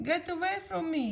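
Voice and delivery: high-pitched yell; acted cry to back off; Squidward. A narrator's reading. Squidward fleeing his bed from SpongeBob, yelling. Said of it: "yelled Squidward".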